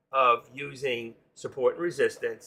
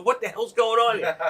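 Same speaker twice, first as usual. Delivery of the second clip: a high, louder, alarmed outburst; alarmed exclamation; 8 semitones higher, 4 dB louder than usual. Retold or imitated. Trader hearing a surprise purchase, high alarmed outburst. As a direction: sound caught off guard by the cha-ching. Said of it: "I'm going".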